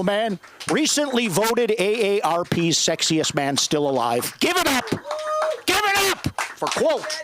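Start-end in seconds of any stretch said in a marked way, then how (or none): 4.4-7.2 high, drawn-out host introduction; big emcee shout-out; announcer call. Real, not acted. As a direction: hype up the crowd for the host.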